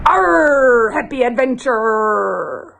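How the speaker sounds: high, drawn-out pirate sign-off; hammed-up pirate farewell. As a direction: stretch it long with growled pirate r's. Pirate sign-off after the joke.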